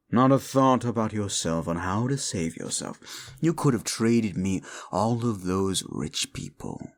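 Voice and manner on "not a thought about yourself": ordinary talk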